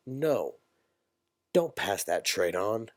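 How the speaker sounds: normal talking voice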